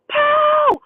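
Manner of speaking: drawn-out, high-pitched scream imitating her. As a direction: scream the alarm down the street. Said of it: "She's screaming".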